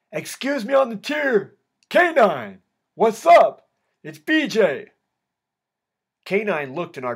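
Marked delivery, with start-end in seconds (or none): 0.1-4.9 high, loud call across the cell block; yelled. A narrator's reading. Inmate calling through the cell door to K nine, yelled. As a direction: holler out to get someone's attention. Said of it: "in the cell door and yelled".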